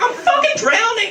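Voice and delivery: high, yelled panic; panicked cry. Retold or imitated. Telling friends he's drowning, high mock panic yell. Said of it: "And I'm like".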